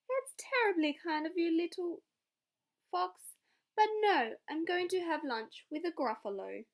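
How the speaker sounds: cheeky mouse turning down lunch; plucky little mouse. Acted. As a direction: brush the fox off sweetly. Mouse answers fox.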